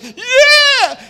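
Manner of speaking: a big high cheer; excited shout. Retold or imitated. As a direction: shout it like the lumber just arrived. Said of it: "real excited and it's like".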